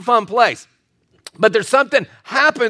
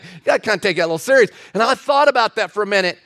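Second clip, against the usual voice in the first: normal talking voice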